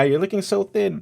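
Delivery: high fussing grandma voice; old woman. Pretend. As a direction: worry like a doting granny. Echoing grandmother voice.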